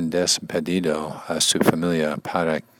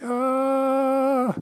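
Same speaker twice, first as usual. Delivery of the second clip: high, drawn-out mock cry of despair. Reader acting Jacob wrestling his attacker, despairing cry. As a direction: act out a long desperate "ah". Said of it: "with all the energy of despair".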